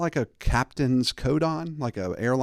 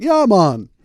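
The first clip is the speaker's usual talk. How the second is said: put-on Caribbean accent; 9 semitones higher, 10 dB louder than usual. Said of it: "He can't be like".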